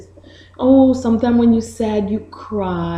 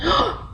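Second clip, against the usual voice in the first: gasp; 12 semitones higher, 3 dB quieter than usual. Shown as a demonstration. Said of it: "Look at her face".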